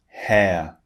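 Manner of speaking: "Hair" said with a long gliding vowel and no final R; "Hair" as a gliding vowel, R left silent; British air vowel. Shown as a demonstration. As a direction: model "Hair" clearly, ending on the vowel without an R. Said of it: "notice it's wide and then it's relaxed".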